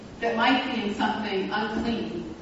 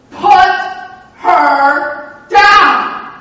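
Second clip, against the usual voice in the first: shouted, much higher and louder; louder command; 9 semitones higher, 14 dB louder, much slower than usual. Retold or imitated. Pastor shouts at the big man to drop her, full shout. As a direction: bellow it so he obeys. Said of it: "she says it a little bit louder".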